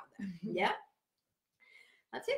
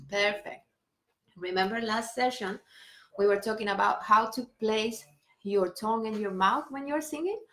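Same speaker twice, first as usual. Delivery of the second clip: normal talking voice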